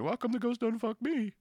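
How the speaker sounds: put-on a girl guest at breakfast, in a high, whiny voice. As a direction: complain like a spurned guest. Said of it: "some girl saying".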